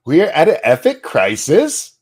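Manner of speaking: high, loud mock-questioning quote; exaggerated upspeak, loudly mocking her; incredulous mocking echo. Mocking the clip just played.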